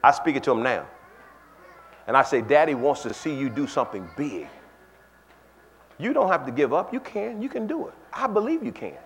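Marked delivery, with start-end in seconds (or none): 2.4-9.0 soft, quiet fatherly reassurance; tender encouragement. Retold or imitated. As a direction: gently build her up.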